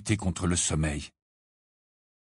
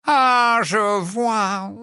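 put-on much higher, knowing old man's voice; a smug, drawn-out ah; sly portrait; 11 semitones higher, 4 dB louder, much slower than usual. Portrait pretending to understand Harry, smug.

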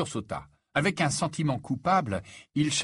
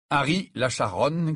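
ordinary delivery, nothing marked

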